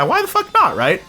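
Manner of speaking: ordinary talk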